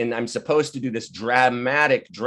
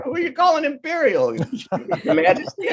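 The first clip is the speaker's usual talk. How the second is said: high, laughing mock outrage; mock indignant retort; 14 semitones higher than usual. Answering the English critics, laughing mock outrage. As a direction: tease them with fake offence.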